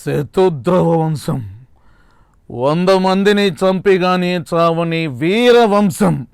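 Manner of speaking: slow, weighty heroic warrior voice; very slow, grave film-hero delivery; Ram Charan Magadheera dialogue. Retold or imitated. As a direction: draw each word out like a vow before battle. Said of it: "ఇప్పుడు రామ్ చరణ్ గారు voice".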